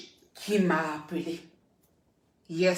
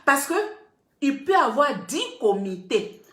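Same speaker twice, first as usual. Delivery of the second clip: ordinary talk, nothing marked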